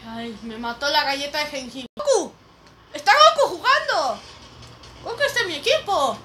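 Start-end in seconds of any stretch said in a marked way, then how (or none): none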